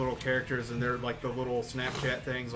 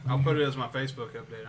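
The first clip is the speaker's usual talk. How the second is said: usual speaking voice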